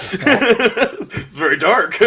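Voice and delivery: slow, laughing through the words, up high. Real, not acted. Cohost still laughing at the dark joke, laughing words.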